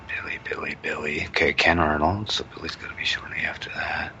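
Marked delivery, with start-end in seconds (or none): 0.1-1.2 whispering the name while searching; breathy whisper under his breath, not for the listener; whispered to himself. Real, not acted. Searching the page, muttering to himself. 2.3-4.1 whispering the name while searching; breathy whisper under his breath, not for the listener; whispered to himself. Real, not acted. Still searching, muttering to himself.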